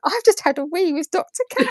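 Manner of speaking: high, giggling through the words; half laughing at her own starstruck thought; giggly inner voice. Retold or imitated. Telling the co-host about meeting Dr Kat, giggling. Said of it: "in my head, I went".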